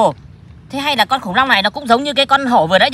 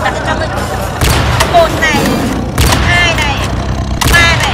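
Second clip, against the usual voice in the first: playful battle count; 4 semitones higher than usual. Pretend. Kid counting off cannon shots at the tiger, playful battle cry. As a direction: call out the shots like a game hero.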